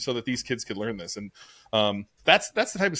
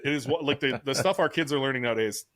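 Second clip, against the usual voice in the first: ordinary talk, nothing marked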